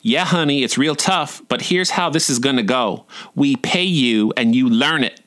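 syrupy southern drawl full of disdain; catty southern drawl. Acted. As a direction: put someone down sweetly in a southern drawl. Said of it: "softly in his cattiest southern drawl".

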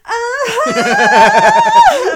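loud high squeal of laughter; real laughing scream; delighted shriek. Real, not acted. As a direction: shriek with joy at the joke.

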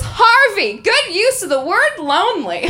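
loud, high motherly call; calling from next room. Retold or imitated. Sister calling to her young son from another room, loudly. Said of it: "from the next room, my sister goes".

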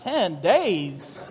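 ten days asked through a laugh; half laughing, half horrified; mock shock with a chuckle; laughing disbelief. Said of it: "I'm like".

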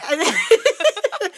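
high burst of laughing; helpless high giggle; laughter. Real, not acted. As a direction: crack up in a high-pitched laugh. Cracking up at the anthem joke.

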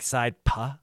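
side pa, the end of puck left open without its K; dropped K. Retold or imitated. Podcaster showing the actor his slip, dropped K on "side pa". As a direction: leave the K off, saying "side pa". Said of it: "you kinda softened the K sound".

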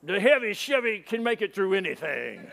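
put-on cocky truck owner; boastful buddy. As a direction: proudly boast in a friend's put-on voice. Cocky friend boasts about his truck.

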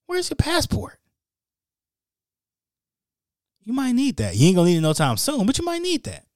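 very high, teasing reminder voice; squeaky inner voice. Retold or imitated. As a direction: nag yourself in a squeaky high pitch.

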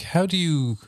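ordinary delivery

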